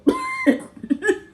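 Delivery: squealing laugh; high laugh. Real, not acted. Laughing at scene.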